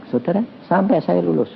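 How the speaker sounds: ordinary delivery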